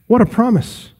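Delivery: usual speaking voice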